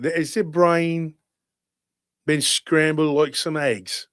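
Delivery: hammed-up Cockney-style British accent; put-on British accent. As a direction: play a mouthy British geezer with a thick accent. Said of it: "in this voice".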